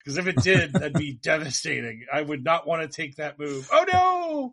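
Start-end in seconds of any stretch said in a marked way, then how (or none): 3.7-4.5 high, loud hammed-up yelp of dismay; a cartoonish cry of alarm; over-the-top pretend panic; mock horror. Mock horror at imagined move.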